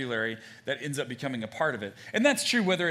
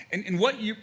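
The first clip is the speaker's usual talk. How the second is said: usual speaking voice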